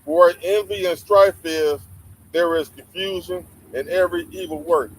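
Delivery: scripture reading. Acted. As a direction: proclaim the verse like from the pulpit. Reads scripture aloud.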